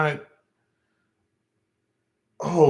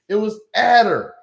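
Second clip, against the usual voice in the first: loud disbelief; 8 semitones higher, 5 dB louder than usual. Real, not acted. Disbelief at word.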